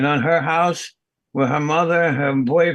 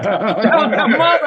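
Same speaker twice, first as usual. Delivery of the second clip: higher and louder, laughing out the words; 8 semitones higher, 6 dB louder than usual. Real, not acted. Retelling a shocking morning to friends, laughing loudly. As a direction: burst out laughing mid-story.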